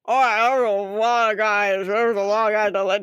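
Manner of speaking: mushy consonants round a big bite; acted chewing-while-talking; mouth full of candy. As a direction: talk through a mouthful of Skittles. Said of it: "He has, like, a handful of Skittles".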